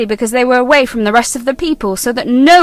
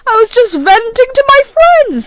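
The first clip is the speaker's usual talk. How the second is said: defensive; 9 semitones higher, 5 dB louder than usual. Acted. Pauline justifies herself.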